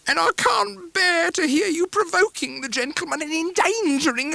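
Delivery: fawning plea, slow at first; pleading mother. Acted. Mrs Heep pleads on.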